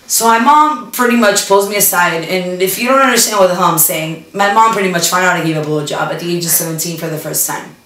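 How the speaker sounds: ordinary talk, nothing marked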